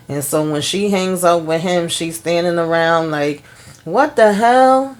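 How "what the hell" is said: drawn-out, higher and louder disbelief; stunned what the hell. Retold or imitated. Andy alone after hanging up, stunned and drawn out. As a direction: let the shock stretch the words out slowly. Stunned once the penthouse news clicks.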